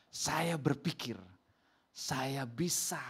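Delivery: slow, drawn-out quoted statement; confident declaration. Retold or imitated. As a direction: say it very slowly, word by word. (ucapan yakin, pelan dan ditekankan)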